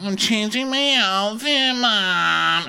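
high, drawn-out mock whine; hammed-up daughter protesting to her mother; whining daughter imitation. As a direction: whine it at Mom in a put-on high voice. Voices Amanda on phone to mother.

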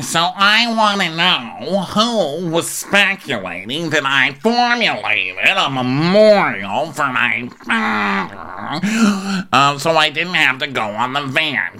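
lightly hammed slow rhyming speech; mock Meredith rhyme. Host imitates Meredith demanding answers, slow sing-song. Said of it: "I had a fourth rhyme".

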